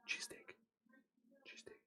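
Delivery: very soft, trailing away to almost nothing; barely audible muttering. Real, not acted. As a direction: repeat it under your breath. Repeats the word to herself.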